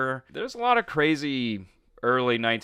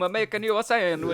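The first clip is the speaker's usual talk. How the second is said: put-on higher Italian mama accent; 10 semitones higher, faster than usual. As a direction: play an old Italian mother, higher. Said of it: "There we go".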